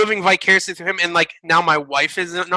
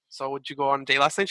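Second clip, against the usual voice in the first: put-on Norwegian accent; 4 semitones lower, 6 dB quieter than usual. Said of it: "a really bad Norwegian accent".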